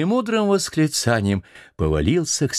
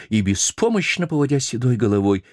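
plain reading voice

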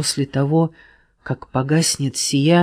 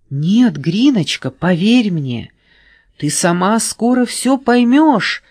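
higher pleading cry; pleading shout; 7 semitones higher, 5 dB louder than usual. A narrator's reading. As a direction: beg loudly. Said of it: "кричал лисёнок".

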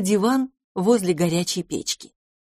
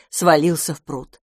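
ordinary delivery

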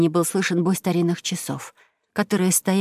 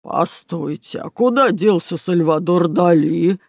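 slow, drawn-out crook voice; baffled robber exclaiming; puzzled exclamation; slower than usual. Acted. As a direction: start held back, then swell in bafflement. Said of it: "воскликнул Бруно".

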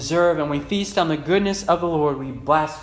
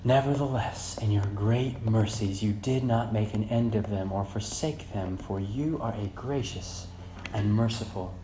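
soft, low, measured pulpit voice; subdued preaching; 3 semitones lower, 7 dB quieter than usual. Real, not acted. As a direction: let it sink in quietly, with pauses. Preacher reads and reflects softly.

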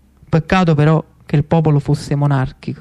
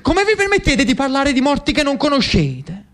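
higher, indignant outburst at the lady; erupting in anger; 9 semitones higher than usual. Acted. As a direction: blow up like a volcano. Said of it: "eruttò come un vulcano". (sfogo indignato di Lucio contro la Pimentel, voce più alta)

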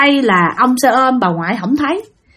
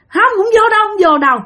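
high-pitched stubborn refusal; defiant refusal; 11 semitones higher than usual. Retold or imitated. Possessed girl refusing the master, defiant. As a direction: balk and keep insisting.

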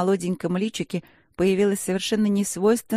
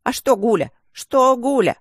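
"Что" rising higher and louder each time, outraged; indignant; 7 semitones higher, 6 dB louder than usual. Acted. Gargoyle protests to the rector, building higher and louder. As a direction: bristle with offended protest. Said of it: "возмутилась Горгулья".

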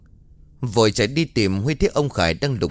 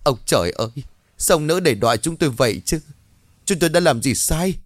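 a touch of tearful lament, higher pitched; weeping lament; 6 semitones higher than usual. Acted. Mother fearing for her husband, lamenting to heaven in tears. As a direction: cry out to heaven, half sobbing. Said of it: "bà liền khóc thầm".

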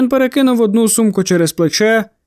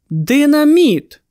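higher, drawn-out cry of realisation; exclaimed; 5 semitones higher, much slower than usual. A narrator's reading. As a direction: exclaim it slowly, pitch up, then falling. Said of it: "вигукнув Кернс".

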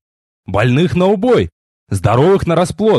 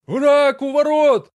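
higher, slower panicked cry from far off; tense distant shout; 11 semitones higher, slower than usual. Acted. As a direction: call the alarm with rising nerves, far away. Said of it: "в нём звучало ещё больше нервного напряжения". (далёкий крик, полный нервного напряжения)

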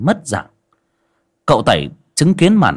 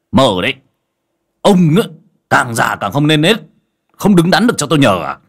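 louder, higher exasperated scolding; 5 semitones higher, 5 dB louder than usual. A narrator's reading. Master Tẩy scolding Đồng while swatting him, louder. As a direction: snap at the shameless old man.

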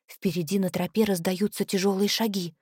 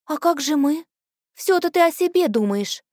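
gently scolding, a little higher; reproachful; 7 semitones higher than usual. Acted. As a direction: chide her kindly. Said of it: "укоряет её Лилуай". (мягкий укор)

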